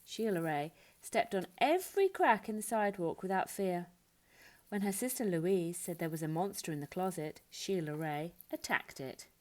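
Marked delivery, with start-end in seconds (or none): none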